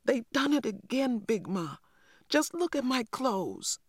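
put-on high, upset child's outburst; a small boy bursting out in a high complaint; angry little boy. A narrator's reading. Little boy exploding at grandmother about muddy clothes, high and upset. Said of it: "stomped angrily into the kitchen and exploded".

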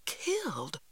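high, falling, hushed disbelief; shocked echo. A narrator's reading. Children echo brother's warning about uncle, shocked. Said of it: "we echoed".

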